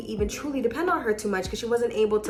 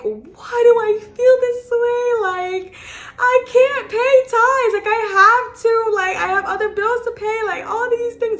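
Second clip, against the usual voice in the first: much higher, strained crying voice; retold crying; 12 semitones higher, 7 dB louder than usual. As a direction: relive the sobbing desperation. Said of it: "I was bawling in my car".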